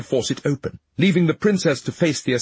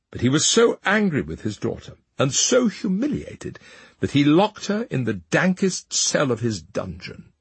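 plain reading voice

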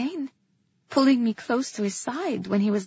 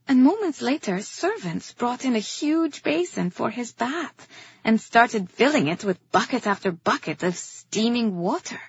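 usual speaking voice

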